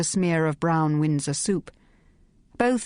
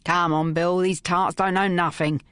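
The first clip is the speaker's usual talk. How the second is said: rough thug. A narrator's reading. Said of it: "exclaimed Thug one".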